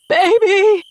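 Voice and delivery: high, very loud desperate cry; frantic parent calling for a lost child; raw, strangled scream. A narrator's reading. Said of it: "My voice is raw and strangled".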